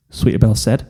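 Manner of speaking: ordinary delivery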